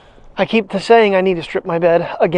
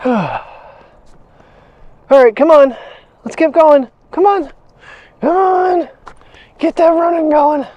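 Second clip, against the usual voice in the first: much higher, louder cheer; high coaxing call; 8 semitones higher, 4 dB louder than usual. Real, not acted. Owner urging her dog across the road in a high loud call.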